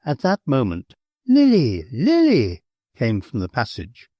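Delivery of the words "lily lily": calling out. A narrator's reading. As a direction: call her from another room. Calling her from the passage.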